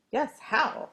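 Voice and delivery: quacking. A narrator's reading. Duck asking the pig how, quacking. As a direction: quack the question out. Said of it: "quacked the duck".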